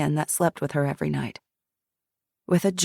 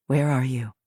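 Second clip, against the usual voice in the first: lower, steady guiding voice; calm questioner; 4 semitones lower than usual. Acted. Jackson guides Shiloh through the memory, low calm prompting. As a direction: gently prompt her to go on.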